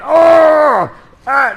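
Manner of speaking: high, loud mock roar; mock angry yell. Coach mocking an angry fighter with a loud high yell. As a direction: act out a raging yell. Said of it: "nobody does this when they're mad".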